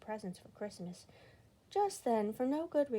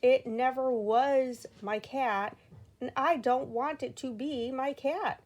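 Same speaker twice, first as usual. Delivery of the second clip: slow, loud exasperated insistence; loud and slow, rolling his eyes; 4 semitones higher, 9 dB louder, slower than usual. Acted. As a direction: spell it out, fed up. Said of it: "rolling his eyes".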